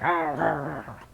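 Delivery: low, soft mock grumble; grumbling groan. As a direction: imitate a reluctant husband's grumbling. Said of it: "they go to grumbling like".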